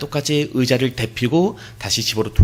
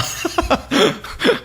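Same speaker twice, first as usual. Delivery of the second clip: wry laugh; 13 semitones higher than usual. Real, not acted. Laughing at the repeated call.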